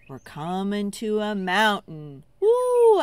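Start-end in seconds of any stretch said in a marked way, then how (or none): 2.4-3.0 high, loud ooh of wonder; big amazed ooh; awed ooh. Acted. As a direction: gasp up at the mountain. Awe at tall mountain.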